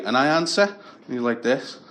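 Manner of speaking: usual speaking voice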